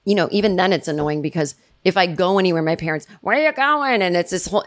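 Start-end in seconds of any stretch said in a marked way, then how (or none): 3.2-4.0 high-pitched parental query; mock nagging parent. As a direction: lightly imitate a nosy parent calling out. Parents nagging after her.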